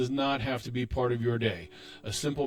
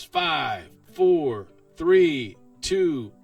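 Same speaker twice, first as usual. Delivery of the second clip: building countdown shout, louder each number; 4 semitones higher, 8 dB louder, much slower than usual. Retold or imitated. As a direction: call the countdown like the crowd. Crowd countdown before the plunge.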